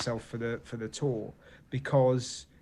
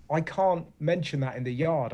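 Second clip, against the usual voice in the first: normal talking voice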